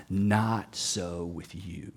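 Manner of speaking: low, slow, hushed whisper; intense whisper. Retold or imitated. Jesus leaning into a disciple's face, hushed intense whisper. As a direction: lean in and whisper it into their face. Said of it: "he leans in and he gets his finger right up in my face".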